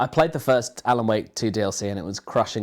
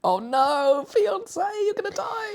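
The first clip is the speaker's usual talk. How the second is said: high mock warning; slower than usual. Mock warning to film character.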